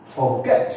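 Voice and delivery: "forget" with GET punched; stress on second syllable. Shown as a demonstration. Teacher listing verbs for learners, second-syllable stress. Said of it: "these all are stressed on second syllable".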